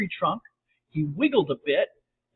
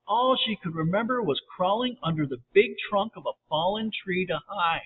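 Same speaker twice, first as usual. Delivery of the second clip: ordinary delivery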